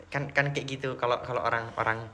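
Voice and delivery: ordinary talk, nothing marked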